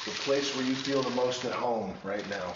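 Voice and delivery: soft, calm meditation voice; guided meditation. Acted. Leads a visualization.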